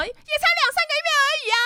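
very high, fast squealed protest; mock outraged shriek. Regina corrects the breakup timeline, mock outraged shriek. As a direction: imitate an indignant ex screeching back. Acting out angry ex's retort.

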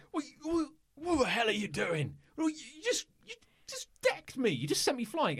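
high, outraged indignant voice; angry man. Acted. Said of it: "a rather angry voice go like".

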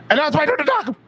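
high-pitched mock interrupting blurt; Tourette's-style ego outburst. Co-host mimicking the host's compulsive interruptions, high-pitched outburst. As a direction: burst in with a squeaky, self-important yelp. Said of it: "where you're like".

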